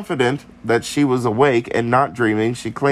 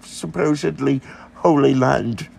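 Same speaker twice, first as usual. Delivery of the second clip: higher, mock-humble petitioning voice; hammed-up humble priest's plea; pleading priest; 6 semitones higher than usual.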